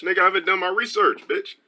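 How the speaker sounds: high, rapid comeback; defensive retort. Real, not acted. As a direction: fire back fast in mock indignation. Snaps back at quoted viewer question.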